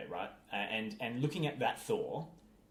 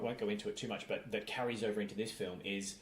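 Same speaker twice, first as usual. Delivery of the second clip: ordinary talk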